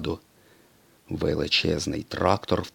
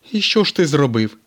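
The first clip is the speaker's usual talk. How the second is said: higher, louder race-car voice; McQueen asking; 8 semitones higher, 7 dB louder than usual. A narrator's reading. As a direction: ask eagerly like a young race car. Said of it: "спитав блискавка Маквін".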